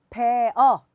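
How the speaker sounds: 베어 loudly as two separate syllables; two-syllable reading. Shown as a demonstration. Teacher showing the learners' mistake, 베어 in two loud syllables. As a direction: split 베어 into 베 and 어. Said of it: "이음절이라고 읽었고".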